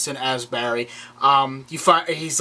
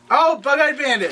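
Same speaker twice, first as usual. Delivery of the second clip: excited recognition; 8 semitones higher than usual. Real, not acted. Host learns who next villain is, excited recognition. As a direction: burst out as it clicks.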